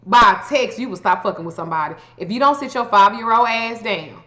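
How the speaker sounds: usual speaking voice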